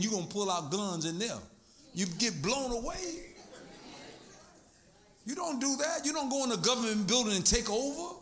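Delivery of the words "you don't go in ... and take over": usual speaking voice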